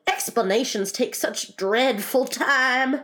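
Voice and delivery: high, slow, exasperated stretch; impatient. A narrator's reading. Griffin dismisses explanations, high and impatient. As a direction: drag out the complaint impatiently. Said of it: "in an impatient tone".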